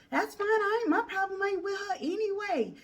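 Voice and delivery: high mocking girl's voice; a high put-on voice for the angry girlfriend. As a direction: imitate her shrill retort up high.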